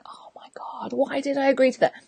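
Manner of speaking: hushed, breathed-out dismay; inner regret. Acted. Coach walking away regretting her yes, hushed dismay. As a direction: groan it under your breath.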